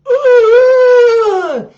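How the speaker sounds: imitating his climax noise, high and loud; ungodly bellow. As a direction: bellow it out like a beast from the depths. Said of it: "This ungodly noise ... starts bellowing out".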